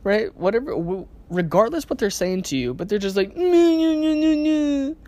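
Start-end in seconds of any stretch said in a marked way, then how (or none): 3.4-5.0 mock crying wail. Imitating an upset person crying.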